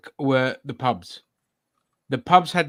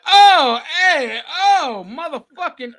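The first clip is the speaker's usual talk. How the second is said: high, loud hammed-up New York accent; 6 dB louder than usual. Friend mocks a tourist with an over-the-top New York accent. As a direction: ham up a brash New Yorker. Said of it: "put on a New York accent".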